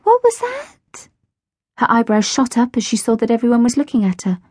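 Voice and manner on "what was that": high, slow startled question; jolted out of a daydream; surprised squeak of a question. A narrator's reading. Mia spun round.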